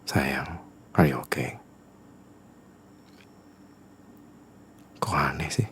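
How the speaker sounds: unvoiced, gentle whisper; pleading whisper. Boyfriend whispering to girlfriend why he must work, pleading whisper. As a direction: whisper the excuse to win her over. Asking girlfriend about odd smile.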